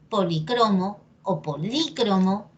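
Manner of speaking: poliCROmo, then poLÍcromo; two accepted stresses. Shown as a demonstration. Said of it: "depende de ti cómo lo quieras decir".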